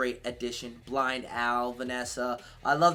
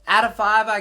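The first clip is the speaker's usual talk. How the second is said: emphatic announcement; 7 semitones higher, 11 dB louder than usual. Real, not acted. Dramatic score announcement.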